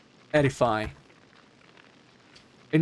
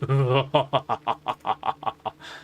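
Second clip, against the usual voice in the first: long amused laugh. Real, not acted. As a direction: burst out laughing. Laughs at own creepy line.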